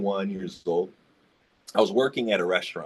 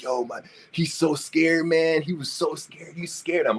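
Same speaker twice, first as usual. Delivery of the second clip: higher excited friend voice; excited report; 7 semitones higher than usual. Retold or imitated. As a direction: tell it like thrilled gossip.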